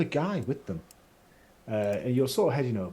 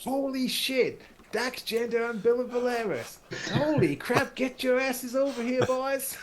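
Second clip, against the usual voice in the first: much higher, delighted calling out; Tyler's excited greeting; 12 semitones higher than usual. Acted. Tyler spots old friends and calls them over, high and delighted. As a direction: shout them over with joy. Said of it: "And he's like".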